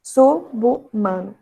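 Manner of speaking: "Subhumano" slowly split as su, bu, mano; syllable division. Shown as a demonstration. Teacher shows the division, slow and syllable by syllable. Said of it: "vai roubar a última letra".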